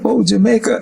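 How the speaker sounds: high, loud offhand guess; a knowing jump to Jamaica; mock guess. Friend's guess voiced.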